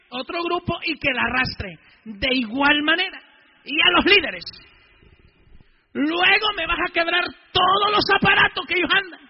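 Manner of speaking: retold furious priest giving orders; furious command. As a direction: bark the order at the gang leader. Priest retells ordering gang leader.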